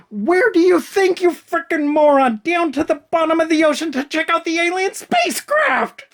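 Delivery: very high, strained yell; exasperated screaming. Real, not acted. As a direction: scream the obvious at an idiot. Screams at the dense character.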